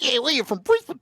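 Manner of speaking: high, proud mock voice of a Brisbane fan. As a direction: put on a smug high voice, bragging about Brisbane. Mocking Brisbane family.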